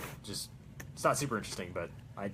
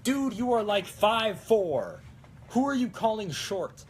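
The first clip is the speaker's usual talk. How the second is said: much higher, slow mock outrage; indignant retort; 8 semitones higher, 5 dB louder, slower than usual. Mock victim retorting to the engineer, indignant. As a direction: fire back as the short victim. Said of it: "It's like".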